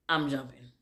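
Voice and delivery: ordinary talk, nothing marked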